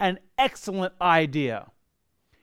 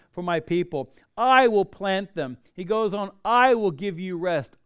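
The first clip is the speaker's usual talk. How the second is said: scripture reading; 4 dB louder than usual. Acted. Reading God's I will statements.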